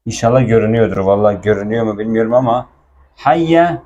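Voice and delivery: normal talking voice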